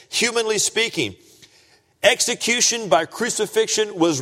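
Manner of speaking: high, loud, emphatic pulpit delivery; full-force preaching. Real, not acted.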